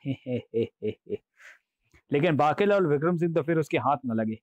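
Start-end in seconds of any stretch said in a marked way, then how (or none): 0.1-1.2 soft he-he chuckle, dying away; secretive demon chuckle; laughing to himself. A narrator's reading. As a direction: snicker privately, letting it fade. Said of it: "मन ही मन में हंस रहा हूँ".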